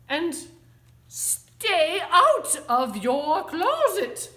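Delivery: high, loud, drawn-out order; stern warning. Mother closes the bedroom door on her kitten, warning sternly. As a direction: give a slow parting command. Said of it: "she added".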